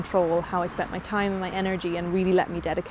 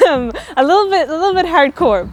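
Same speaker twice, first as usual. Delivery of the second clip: loud, high, laughing through words; laughing while talking; 10 semitones higher, 12 dB louder than usual. Real, not acted. Laughing about her own rule.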